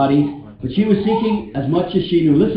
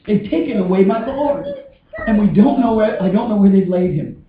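higher-pitched weeping Mary, lightly acted; anguished complaint, raised pitch; acted tearful distress; 5 semitones higher than usual. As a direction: plead as if close to tears. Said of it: "That's why I'm crying".

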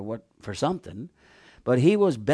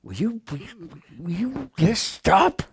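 urging to stop; 4 semitones higher than usual. Acted. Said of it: "They urged him".

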